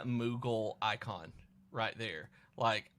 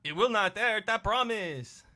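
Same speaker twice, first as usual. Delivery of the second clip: put-on high, louder French-accented coaxing; high character voice; 8 semitones higher, 5 dB louder than usual. Voices French slime game character.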